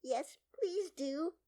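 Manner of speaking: high, slow, eager plea; pleading. A narrator's reading. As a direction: beg sweetly, stretching the words. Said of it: "pleaded Alice".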